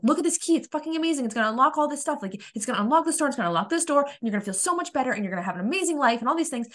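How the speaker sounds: high-pitched, excited pitch; eager urging. Retold or imitated. Showing everybody an amazing key, excited urging. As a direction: reenact pushing a discovery on everyone, rushing and high.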